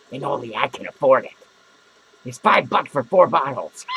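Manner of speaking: ordinary talk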